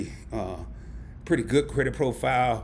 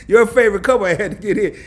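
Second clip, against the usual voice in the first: laughing; 5 semitones higher, 8 dB louder than usual. Real, not acted. Laughing at chat.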